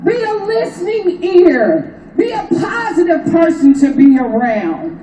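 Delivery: impassioned rally preaching, pitched high. Acted. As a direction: preach it to the crowd. Rally speech, high impassioned delivery.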